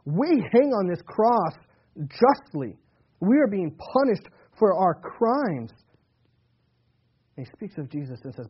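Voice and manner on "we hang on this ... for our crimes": louder, slow, weighty rebuke; rebuking criminal. Acted. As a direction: hammer home that they deserve it. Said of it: "This criminal is saying".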